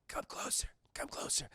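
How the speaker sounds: acted dying man's whisper; hushed, voiceless beckoning; feeble whisper. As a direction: whisper as if nearly gone. Dying Blondie beckons Tuco.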